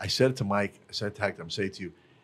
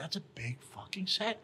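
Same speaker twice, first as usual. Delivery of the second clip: slow, higher, breathless whisper; 6 semitones higher, 9 dB quieter, slower than usual. Real, not acted. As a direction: lean in and whisper it, impressed. Emphatic aside.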